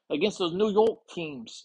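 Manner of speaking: high-pitched mock New York accent; attempted New York accent. Host playfully tests an accent on team names, mock New York accent. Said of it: "Is that a New York accent?".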